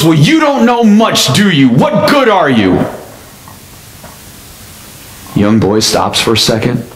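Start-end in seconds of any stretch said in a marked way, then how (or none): 0.0-2.8 much higher, sneering demand; hammed-up exasperated driver, pitched high; angry businessman. Lost businessman snaps at the boy, mock exasperated. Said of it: "is upset beyond belief".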